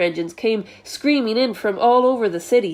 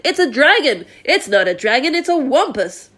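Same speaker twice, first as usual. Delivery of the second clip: higher, frantic guessing; panicked cries; 5 semitones higher than usual. A narrator's reading. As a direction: blurt each name out in fright. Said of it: "they cried".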